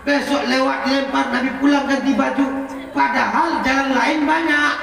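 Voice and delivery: preaching at full force. Acted. Preacher stirring the congregation, high and loud. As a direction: drive the story home to the crowd.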